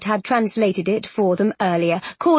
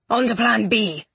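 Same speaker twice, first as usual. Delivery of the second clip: plain reading voice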